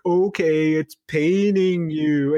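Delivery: slow, drawn-out mocking echo; patronizing, stretched-out words; condescending. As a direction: echo the caller as if in air quotes. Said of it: "totally being condescending".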